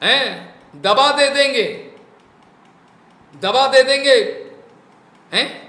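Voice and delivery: acted furious master issuing threats; angry King Rahugana. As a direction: berate a disobedient servant, building to a shout. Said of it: "रुहुगन कितना बोलना चाहता".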